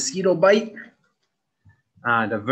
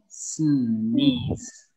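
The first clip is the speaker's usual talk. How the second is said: normal talking voice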